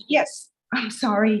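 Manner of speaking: normal talking voice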